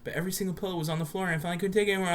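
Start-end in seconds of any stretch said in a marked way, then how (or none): none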